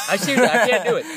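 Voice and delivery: laughing through the words; giving up on the accent with a laugh. Real, not acted.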